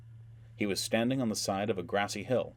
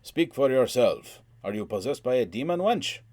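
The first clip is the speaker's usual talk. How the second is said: booming basso; 5 semitones higher, 7 dB louder than usual. A narrator's reading. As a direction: boom it out like a burly warrior. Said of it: "Hakim slash Slavatsky's basso boomed".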